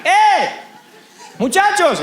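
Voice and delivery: high shout across the water; shouting from shore. Acted. Lord hails the fishermen from the shore, high calling shout.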